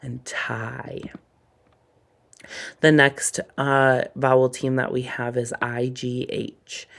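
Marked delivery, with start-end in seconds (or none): 0.0-1.0 slow unvoiced whisper of "pie and tie". Shown as a demonstration. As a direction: whisper the examples slowly. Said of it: "Notice that I sound".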